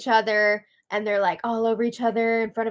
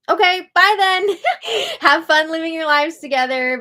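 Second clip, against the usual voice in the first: higher, louder sarcastic brush-off; sarcastic dismissal; 6 semitones higher, 6 dB louder than usual. Real, not acted. Vlogger mocking the couple's choice, sarcastic brush-off. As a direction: wave them off sarcastically.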